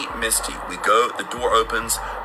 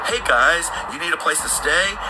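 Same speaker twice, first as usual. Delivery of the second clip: put-on higher-pitched friendly young girl; lightly acted girl greeting strangers, pitched up; 7 semitones higher than usual. Young girl offering travellers a place to stay, pitched up. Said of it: "this young girl comes up".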